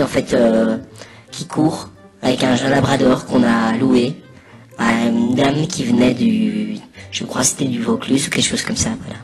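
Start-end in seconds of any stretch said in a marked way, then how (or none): none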